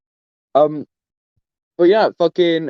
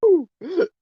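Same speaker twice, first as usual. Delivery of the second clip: excited whoop. Real, not acted. Cheering at the outro.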